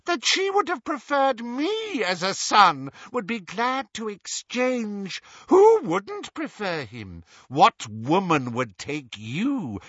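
high, sinister jeer. Acted. Evil duet tormenting Ron about his mother, jeering. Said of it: "while Riddle Hermione jeered".

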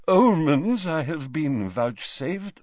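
high, slow, dreamy seer voice; tipsy mystic seer. Acted. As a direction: drift through it like a vague prophetess.

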